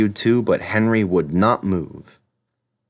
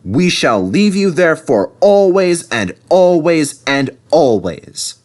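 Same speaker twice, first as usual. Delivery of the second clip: loud, slow, higher stern verdict; 7 semitones higher, 5 dB louder, slower than usual. A narrator's reading. Crew sentencing Henry, slow loud stern voice. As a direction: pronounce the sentence slowly. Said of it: "They told Henry".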